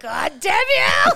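high, loud cry of outrage; mock yell at God. Cursing God at the pearly gates, a loud mock yell.

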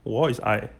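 ordinary talk

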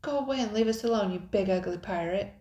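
fast, loud, high outburst; angry demand. A narrator's reading. Girl confronts the pirate at the hideout, demanding. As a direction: order the bully away. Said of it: "demanded Nicole".

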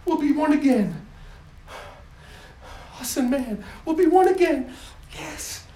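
high, loud with gasps between; overwhelmed with delight; breathless joy. Acted. God announcing his son, breathless high joy.

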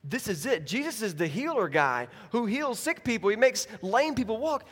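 rising, hopeful inner voice; eager hope. Pretend. Paralyzed man hoping for healing, growing excited. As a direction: build from hushed to thrilled. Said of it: "you're like".

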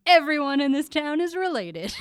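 loud, high-pitched yell; worked-up, incredulous holler; exasperated shout. Real, not acted. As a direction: shout the absurd explanation. Yelling out the absurd plot twist.

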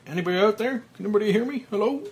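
high, loud desperate calls; panicked calling. Acted. Frank in the coffin calling out, panicked shouts. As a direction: shout into the dark, scared. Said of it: "Frank's panic breaths".

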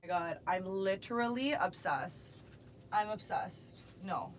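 very soft, under the breath; hushed awe. Real, not acted. Vlogger alone with her perfect Cinderella heels, hushed awe. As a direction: murmur it quietly to yourself.

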